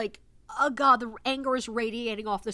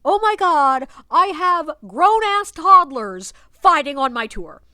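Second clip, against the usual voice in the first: louder, slow, disbelieving oh my God; exasperated outburst; 4 semitones higher, 10 dB louder, slower than usual. Retold or imitated. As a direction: vent at the grown-ass toddlers. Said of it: "And I'm like".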